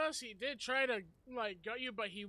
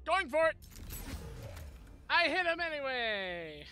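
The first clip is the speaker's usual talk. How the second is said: excited; 5 semitones higher, 4 dB louder than usual. Real, not acted. Attacking in the game.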